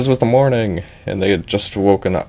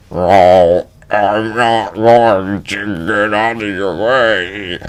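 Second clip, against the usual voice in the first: lightly voiced, slow refusal; stubborn fairy; slower than usual. Giant fairy refusing Edge, slow stubborn voice. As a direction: drawl out the refusal.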